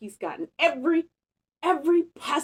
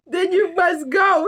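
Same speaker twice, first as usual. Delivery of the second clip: high, loud, half-laughing mock sniffle; a hint of hammed-up tears; sniffling; 9 semitones higher, 7 dB louder than usual. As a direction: sniffle it out like a sulky bear. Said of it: "he sniffled".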